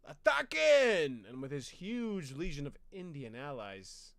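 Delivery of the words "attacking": slow, high, loud war cry; battle cry. Retold or imitated. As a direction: yell the charge. Pizarro's attack cry.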